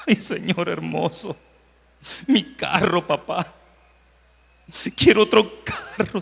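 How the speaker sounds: put-on slow, childish whine for a new car; mock tearful begging; mock whining. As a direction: ham up a crybaby asking for a car. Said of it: "Yo estaba chillando y estaba llorando".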